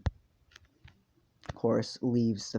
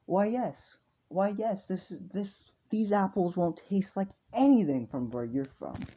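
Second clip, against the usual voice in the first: high, put-on smug seller; oily sales pitch, pitched up; smug merchant; 8 semitones higher, 4 dB louder than usual. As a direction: boast through a big self-satisfied grin. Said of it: "shit-eating grin on his face".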